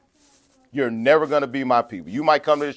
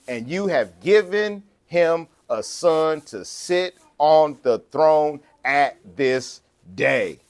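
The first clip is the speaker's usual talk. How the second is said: slow, measured pulpit reading, pitched up; scripture reading; 3 semitones higher, slower than usual. Acted. Preacher reads Solomon's prayer to congregation, slow scripture reading. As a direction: read the verse out phrase by phrase, deliberately.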